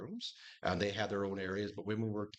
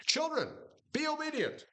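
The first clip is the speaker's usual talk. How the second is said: very high-pitched mock command to children; calling out a command; 3 dB louder than usual. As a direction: call it out high, like summoning the kids. Preacher voices command to children.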